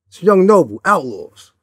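loud, hard-edged phone intro, imitating; aggressive intro. Rapper grabbing the phone, announcing himself, mock aggressive. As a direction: bark the name at him like a challenge. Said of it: "Like, real aggressive".

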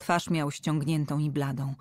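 ordinary delivery

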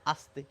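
আস্তে said low and soft with a plain dental s, as the common mistake; a soft repeat of the wrong আস্তে with a clear s; wrong same sound. Shown as a demonstration. Teacher softly shows the common mistake, আস্তে with a plain s. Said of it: "কিন্তু তা একেবারেই নয়".

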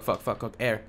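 normal talking voice

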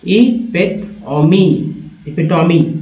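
इपिटोमी drawn out slowly, syllable by syllable; slow pronunciation demo. Shown as a demonstration. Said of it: "तो इस तरह से".

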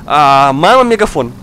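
ordinary delivery

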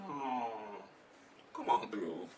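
low, hushed, knowing aside; mock officer reaction. Officer seeing the scan waves traveler through, mock hushed reaction. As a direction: drop low and soft, playing the officer.

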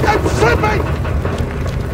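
high, strained breathless yell; terrified cry. Acted. Friend losing his grip above the drop, crying out in terror. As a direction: cry out as your hands give way.

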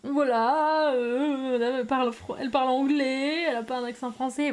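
drawn-out, high mock "excuse us"; réaction moqueuse imitée. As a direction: mimic sarcastic onlookers, stretching words loudly. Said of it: "ils réagissent comme ça". (imitation moqueuse, mots traînants et exagérés)

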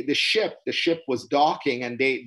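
ordinary delivery

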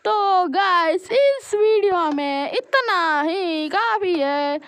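drawn-out, stretched words; slow motion. Pretend. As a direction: speak like a slowed-down video. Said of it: "मैं slow motion में बोल रहा हूँ".

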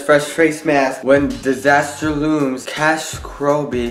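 reads the label word by word, slowly; sounding out the label text slowly; slow reading aloud. Real, not acted.

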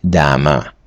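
"дама" read out slowly as the French word; low, careful model pronunciation of "дама". Shown as a demonstration. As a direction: say "дама" with the final written e silent. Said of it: "І слово читається".

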